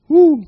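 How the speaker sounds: high, loud "woo"; exultant whoop. Real, not acted. Preacher praising God mid-sermon, loud high whoop. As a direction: let out a sudden praise whoop.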